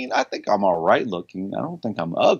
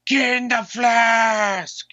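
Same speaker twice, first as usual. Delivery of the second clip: movie quote voice; 11 semitones higher, much slower than usual. Acted. Shouted odd movie quote.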